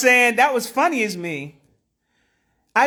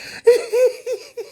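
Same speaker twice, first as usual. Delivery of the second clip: high cackle; 11 semitones higher than usual. Real, not acted. Laughing fit.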